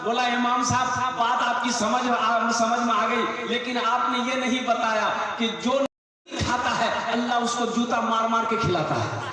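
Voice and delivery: loud, fervent sermon voice; full-force preaching. Acted. Preacher exhorting the young men who left home to sit here: loud, fervent preaching.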